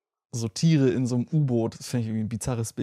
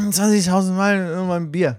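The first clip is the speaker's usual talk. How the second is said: put-on slow, slurred drunk voice; drunken slurring; 4 semitones higher, 4 dB louder than usual. Shown as a demonstration. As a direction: drag it out like a drunk.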